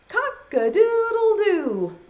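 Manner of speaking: high, drawn-out crow; rooster crow. Acted. Teacher leading children in the rooster's call, crowing. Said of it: "then everyone has to say".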